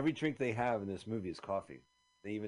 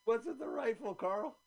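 much higher, strange put-on voice; high cartoon voice; 12 semitones higher than usual. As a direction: squeak it out in a put-on voice. Acted scene.

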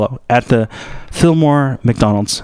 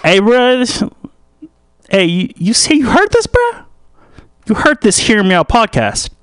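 high-pitched put-on street voice; high, animated local character; excitable local; 9 semitones higher than usual. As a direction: call out to a pal, voice pitched up.

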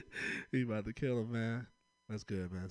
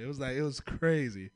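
ordinary talk, nothing marked

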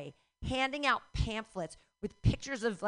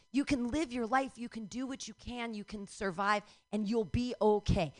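normal talking voice

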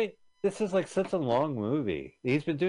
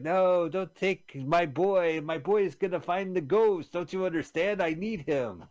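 pleading parent; 6 semitones higher, slower than usual. Retold or imitated. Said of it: "And they're like".

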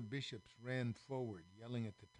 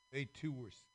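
ordinary delivery, nothing marked

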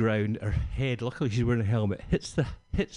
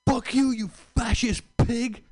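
high, slow yelled rant; a homeless man yelling at the police officer, high and slow; 12 semitones higher, slower than usual. Retold or imitated. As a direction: shout it like a street ranter.

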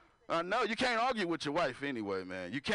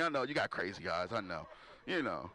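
usual speaking voice

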